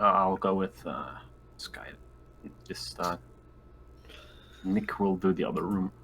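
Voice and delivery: ordinary delivery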